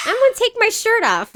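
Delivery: put-on high-pitched little boy announcing his plan; four-year-old boy. Mother retelling her four-year-old's sudden announcement, high little-boy voice. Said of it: "all of a sudden he was like".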